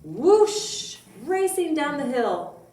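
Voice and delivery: put-on lively storybook voice for kids; reading to children. Teacher reading a Christmas story to children, lively storybook voice. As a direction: read it bright and expressive. Teacher reads picture book.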